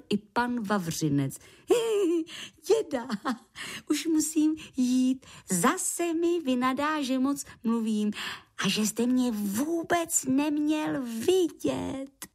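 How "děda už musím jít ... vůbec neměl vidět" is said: high, fretful creature voice; worried little creature. Acted. Lichožrout must leave before grandpa scolds, high and worried. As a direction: fret in a small high voice. Hyhlík hears grandpa knocking.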